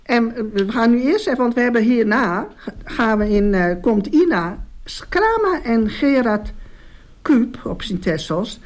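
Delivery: normal talking voice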